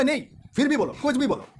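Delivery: insistent friend. Retold or imitated. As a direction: push him to just say anything. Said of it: "बोला".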